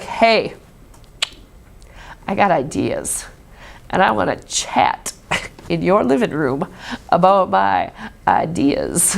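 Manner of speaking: low, lazy schmoozing come-on; lightly voiced schmoozing philosopher. Philosopher sweet-talking wealthy women, low and lazy. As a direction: play a smooth talker angling for patronage. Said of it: "and be like".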